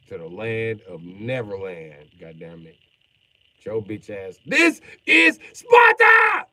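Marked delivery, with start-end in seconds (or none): 4.5-6.5 high, slow, loud battle cry; Sparta shout. Pretend. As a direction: roar it like a movie warrior. Movie quote shouted.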